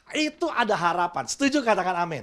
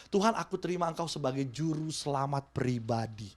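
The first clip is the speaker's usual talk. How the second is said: soft, lowered sincere prayer; a hushed personal prayer; 5 semitones lower, 7 dB quieter than usual. Pretend. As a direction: pray it quietly and sincerely. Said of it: "doa waktu kita pertobatan".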